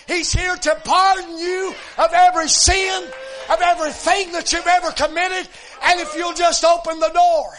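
loud, ringing preacher's cry; preaching at full force. Acted. Preacher exhorting the congregation, loud and rising. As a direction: lift the church with it.

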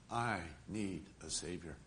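low, hushed admission of need; quiet confession. Retold or imitated. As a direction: say it low and soft, as a humbled confession. Peter at bottom, confessing need.

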